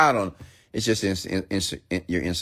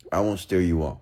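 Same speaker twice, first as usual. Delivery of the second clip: ordinary talk, nothing marked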